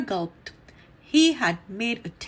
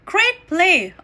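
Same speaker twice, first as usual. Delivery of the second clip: louder and higher, called across the field; calling out praise; 6 semitones higher, 6 dB louder than usual. A narrator's reading. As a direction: cheer him from the stands. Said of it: "Arthur called".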